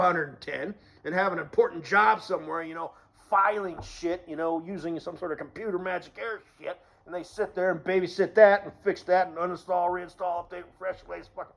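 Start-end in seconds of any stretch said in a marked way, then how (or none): none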